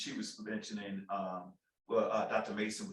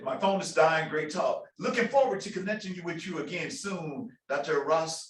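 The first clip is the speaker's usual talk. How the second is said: put-on playful high Jamaican accent, louder; 7 semitones higher, 6 dB louder than usual. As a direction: read it in a mock Jamaican accent. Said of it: "which is my terrible accent, Jamaican accent".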